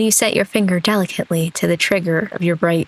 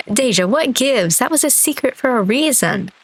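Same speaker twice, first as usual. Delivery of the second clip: high, exasperated protest; indignant protest; 7 semitones higher than usual. Acted. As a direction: complain in a high voice at being given away. Upset that a secret is told.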